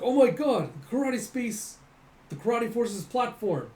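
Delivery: high, loud startled cry that eases off; shocked exclamation. Acted. Character gasping at the giant platform, high and startled. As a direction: gasp it out in disbelief.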